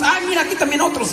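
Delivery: high, offhand mock onlooker; mock casual bystander. Priest imitating a jaded bus passenger, high and offhand.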